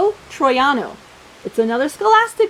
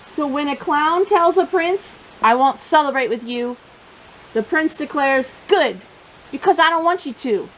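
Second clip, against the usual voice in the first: lively, expressive picture-book reading; reading to children; 4 semitones lower than usual. Acted. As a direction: tell the story to a room of little kids.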